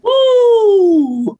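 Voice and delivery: a loud, high whoop of real delight; excited whoop. Real, not acted. Given a tough either-or choice, guest whoops loudly. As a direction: let out a big excited whoop.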